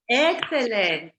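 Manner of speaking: praise. Real, not acted. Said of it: "praising a student's answer".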